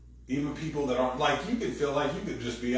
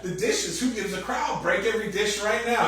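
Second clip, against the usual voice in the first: exasperated husband; 10 semitones higher, 6 dB louder than usual. Retold or imitated. Husband fed up after an hour of attitude, mock rant. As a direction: blow up half laughing, fed up. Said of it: "he's like".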